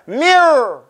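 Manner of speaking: Mirror with a rolled, repeated r from a kesra. Shown as a demonstration. Teacher showing the student how to roll it. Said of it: "you gotta make it roll".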